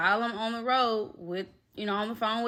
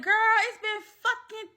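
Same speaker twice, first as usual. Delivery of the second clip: high-pitched retold outburst; outraged; 14 semitones higher, 4 dB louder, faster than usual. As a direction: reenact an exasperated protest, pitched way up.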